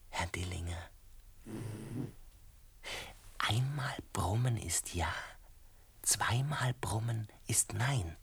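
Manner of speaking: hushed whisper close to a sick man's bed. Acted. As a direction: breathe the words softly so only he hears. Speaking to bedridden sick man.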